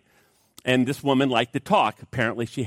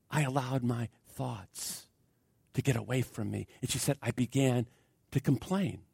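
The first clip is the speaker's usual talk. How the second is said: quiet confession; 10 dB quieter than usual. Retold or imitated. Woman confesses her fall.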